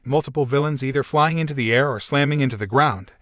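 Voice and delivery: usual speaking voice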